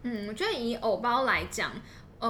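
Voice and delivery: ordinary talk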